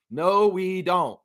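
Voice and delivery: drawn-out calm "No, we don't"; slow, settled repeat. Real, not acted. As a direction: let it sink in slowly. Repeats the answer more calmly.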